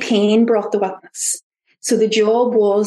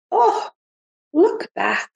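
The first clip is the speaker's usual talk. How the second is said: lightly surprised, noticing inner observer; curious observer voice; 8 semitones higher than usual. Acted. Speaker voices her inner awareness noticing things, curious observer voice. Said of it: "kind of voice".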